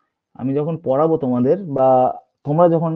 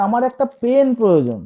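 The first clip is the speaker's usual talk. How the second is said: "পেন" hit hard in a higher voice; stress on পেন; 5 semitones higher, 3 dB louder than usual. Shown as a demonstration. Teacher telling students only a pen will do, "পেন" stressed. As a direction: lean on "পেন" above the other words. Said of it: "আমি পেনটাকে জোর দিয়ে বলছি".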